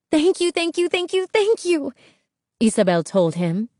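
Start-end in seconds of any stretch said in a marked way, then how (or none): 0.1-1.9 high, bubbling thanks; overjoyed girl. A narrator's reading. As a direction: gush with delight, bouncing. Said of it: "Isabel's heart soared, and she hopped up and down".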